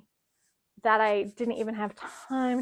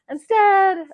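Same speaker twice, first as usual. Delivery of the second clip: usual speaking voice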